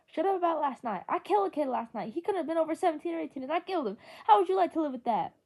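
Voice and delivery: high, loud anguished cry; crying outburst. A narrator's reading. Johnny, guilty over the killing, shouts tearfully at his friend. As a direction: break down shouting through tears. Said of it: "He was crying".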